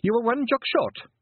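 very high Scottish pixie voice; a proud wee Feegle. A narrator's reading. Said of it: "he added helpfully".

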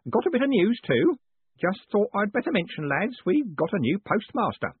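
put-on high-pitched, eager old-hand Grote voice; high, chatty character voice; deferential lodging keeper. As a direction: pass on news to your tenants, pitched well up.

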